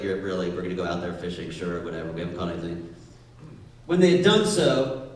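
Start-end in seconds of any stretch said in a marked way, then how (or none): 0.0-2.9 low, soft, fast eye-rolling; whatever, sure, under breath; sarcastic. Retold or imitated. Peter brushing Jesus off, low and sarcastic. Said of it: "Peter's like".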